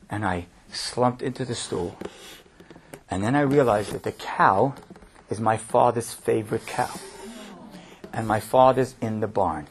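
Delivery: soft and low, drawing the listeners in; hushed retelling. Retold or imitated.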